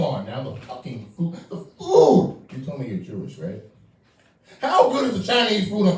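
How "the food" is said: high, loud cry of delight; excited exclamation. Real, not acted. Comic hyping holiday food to the crowd, loud and high. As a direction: belt it like it's the best part.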